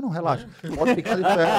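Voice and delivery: a high, loud line with laughter; amused retelling of the boss; laughing. Retold or imitated. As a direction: quote the boss while laughing. Boss voiced, speaker laughing.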